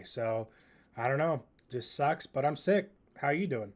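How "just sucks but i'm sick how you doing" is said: ordinary talk, nothing marked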